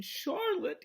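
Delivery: high, drawn-out moan of grief. A narrator's reading. Wilbur grieving over Charlotte, moaning. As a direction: moan her name in sorrow. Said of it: "he moaned".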